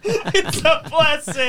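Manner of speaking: high, slow, laughing yell; laughing mock shout. Friend mocking with a high laughing yell. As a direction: belt it out laughing.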